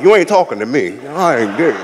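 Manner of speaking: lightly voiced defensive straight guy; a hint of a tough guy brushing it off; imitating a defensive dude; mock straight guy. Mocking straight men in the crowd.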